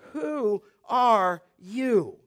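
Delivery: very slow, spaced-out question; measured reading. Shown as a demonstration. Preacher reading out verse twenty-five, slow measured question. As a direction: read the question word by word, slowly. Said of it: "you can read it this way".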